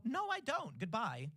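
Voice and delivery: high, curt brush-off. Retold or imitated. Passerby brushing off a canvasser, curt and high. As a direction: slam the door on a canvasser. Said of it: "And people go".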